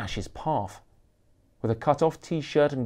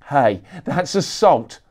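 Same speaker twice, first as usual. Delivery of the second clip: loud, slow snarl; 3 semitones higher, 8 dB louder, slower than usual. Acted. Photographer accusing the rock star, slow loud snarl. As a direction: spit the accusation out slowly. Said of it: "snarled Gonzo".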